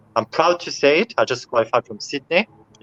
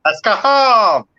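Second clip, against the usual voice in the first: mom's voice; 9 semitones higher, 5 dB louder, slower than usual. Retold or imitated. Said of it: "My mom said to me".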